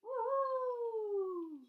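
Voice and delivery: high, loud whooping woohoo; excited cheer. Pretend. Reading to kids, cheering the dancing boy in the picture with a loud woohoo. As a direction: cheer him on like a fan at a show.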